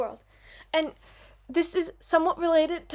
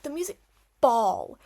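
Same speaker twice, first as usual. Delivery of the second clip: ordinary talk, nothing marked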